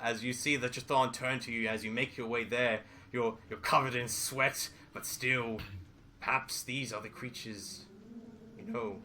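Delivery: ordinary talk, nothing marked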